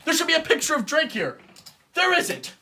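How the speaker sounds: usual speaking voice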